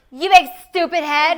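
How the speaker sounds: put-on high, loud, slow childish taunt; sling it like a bratty kid; childish name-calling. Sister mocking her own childish insults, high bratty taunt. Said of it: "call names like a child".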